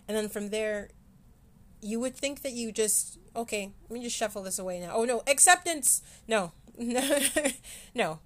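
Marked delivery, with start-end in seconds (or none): 3.4-6.0 hammed-up fake alarm; mock panic. Mock avoidant inner voice. 6.6-7.9 bright laugh at own joke; short laugh. Real, not acted.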